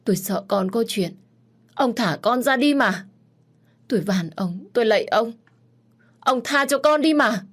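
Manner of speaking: rising to high, loud tearful pleading; desperate begging. A narrator's reading. Mother begging her husband to free their son. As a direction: beg him, voice climbing to a wail. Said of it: "khẩn khoản van xin".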